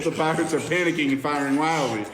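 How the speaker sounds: ordinary delivery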